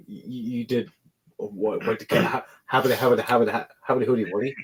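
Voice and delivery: tongue-tied bafflement, softening at the end; flustered stammering. Acted. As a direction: sputter on repeated broken starts. Leader stammers in disbelief at character.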